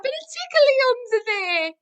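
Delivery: high, loud tickle squeal; playful squeal. Acted. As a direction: squeal the tickle line at toddlers. Tickle punchline of toddler rhyme.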